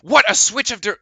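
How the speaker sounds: normal talking voice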